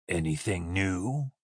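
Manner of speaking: low, slow, still drowsy; waking up. A narrator's reading. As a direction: ask slowly in a low voice, shaking off sleep. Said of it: "Clarity was coming into his voice as he woke up".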